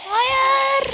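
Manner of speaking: tiz ve yüksek bir hayır çığlığı; acı içinde haykır; çaresiz bağırış. Real, not acted. Gol yiyince çaresizce bağırıyor. (gol yiyince yüksek sesle çaresizce bağırıyor)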